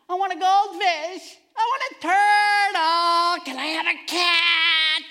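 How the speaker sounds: put-on high, loud, slow whiny kid pleading; begging kids. Preacher voicing kids begging for pets, high whiny plea. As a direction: whine for a pet like a child.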